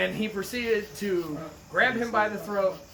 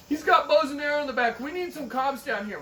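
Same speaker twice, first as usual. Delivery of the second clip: alarmed protester; 7 semitones higher, 4 dB louder than usual. Retold or imitated. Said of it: "one of them goes".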